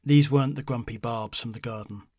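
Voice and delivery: usual speaking voice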